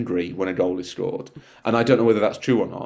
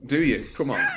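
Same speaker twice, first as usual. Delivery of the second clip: usual speaking voice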